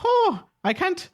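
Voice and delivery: very high, squeaky mock-Scottish leprechaun; bad leprechaun accent. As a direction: chirp it in a badly faked Scottish accent. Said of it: "They're also a leprechaun".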